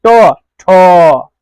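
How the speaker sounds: ठो drawn very slowly with a breathy release; stretched, breathy ठो; slow aspirated demo. Shown as a demonstration. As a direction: hold the ठो with a puff of air. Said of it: "खत्म होता है कि नहीं".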